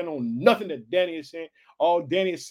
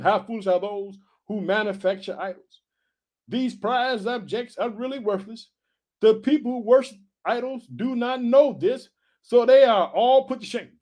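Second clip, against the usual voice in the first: preaching scripture; 4 semitones higher than usual. Acted. Reads scripture again.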